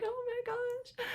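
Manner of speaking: very high, soft, drawn-out awe; hushed amazement. Retold or imitated. Mother retelling the first heartbeat, hushed amazement. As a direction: imitate a tiny squeak of wonder.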